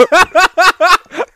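loud high laugh breaking out; burst of laughter. Real, not acted. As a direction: crack up loudly at the absurdity. Speaker laughs at story.

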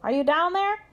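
high rising question; pig calling down. A narrator's reading. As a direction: call down into a well. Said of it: "said Pig".

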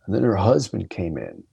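usual speaking voice

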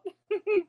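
short, high-pitched laugh; real laugh. Real, not acted. Guest agreeing the night has been wild, real high laugh. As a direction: let out a brief high laugh.

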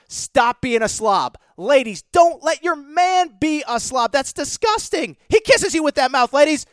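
wag a finger at the slobs; mock disgust. Host scolding slobby men to the ladies, hammed-up disgust.

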